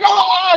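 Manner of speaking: high loud shriek of a shocked crowd; crowd's mock shriek. Crowd seeing wrestlers pantsed, high mock shriek. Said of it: "everybody's like".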